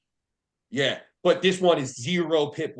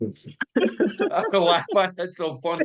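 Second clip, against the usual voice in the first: slow, laughing through the words; real helpless laughter while talking; cracking up; 4 semitones higher, slower than usual. Real, not acted. Host cracking up at joke.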